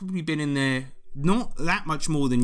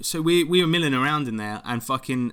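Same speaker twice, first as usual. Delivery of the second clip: ordinary talk, nothing marked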